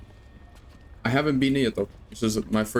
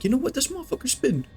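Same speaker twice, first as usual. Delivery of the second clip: mock impressed; 13 semitones higher, faster than usual. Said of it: "be like".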